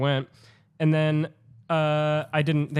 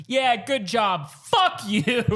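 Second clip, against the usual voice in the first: slow, higher sarcastic jeer; imitating a sarcastic guy; 6 semitones higher, much slower than usual. Bar guy sneers at the mouse rescuer, slow and higher. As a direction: drag it out mockingly, slowly. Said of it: "some guy was like".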